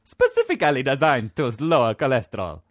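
a hint of Italian accent on specifically; mock Italian. Host reading the box slogan, light Italian accent. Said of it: "put it on the box".

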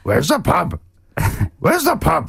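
high, croaky little Jedi master; old swamp sage voice; Yoda voice. Acted. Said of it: "the beginning of a Yoda noise".

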